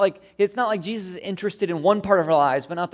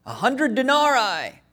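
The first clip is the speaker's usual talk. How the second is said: soldier's answer; 7 semitones higher, 4 dB louder, slower than usual. Retold or imitated. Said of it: "So he says".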